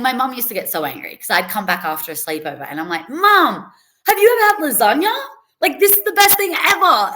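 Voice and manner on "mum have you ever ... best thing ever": much higher, louder, fast and breathless; squealing kid telling mum about lasagna; excited gush. Retold or imitated. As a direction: burst in thrilled about a discovery.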